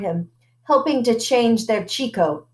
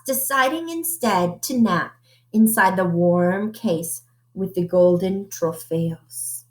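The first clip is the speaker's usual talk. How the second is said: usual speaking voice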